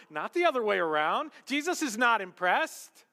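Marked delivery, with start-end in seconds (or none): 1.4-3.0 louder, higher proclamation; emphatic. Acted. Preacher proclaims the point to the congregation. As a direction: ring it out to the back row. Preacher repeats the line loudly.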